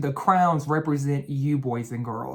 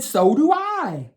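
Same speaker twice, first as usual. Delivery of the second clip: much higher, louder, slow character voice; green crown; 9 semitones higher, 5 dB louder, slower than usual. Acted. Green crown agrees with yellow crown, high slow voice. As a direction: chime in high and drawn out. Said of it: "and the green crown said".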